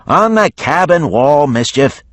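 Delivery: John Moot yelling to Mischief. A narrator's reading. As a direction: call out across the deck. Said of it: "John Moot yelled".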